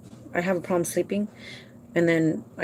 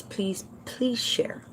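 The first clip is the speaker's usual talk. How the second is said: whisper; 3 semitones higher, 8 dB quieter, much slower than usual. Real, not acted. Begging viewers at video end.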